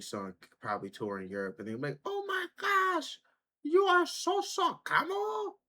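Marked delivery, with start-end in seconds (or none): 2.0-5.6 very high, slow mock European accent; vague European accent. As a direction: gush like a starstruck European fan in a fake accent. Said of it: "I don't know what accent I was going for there".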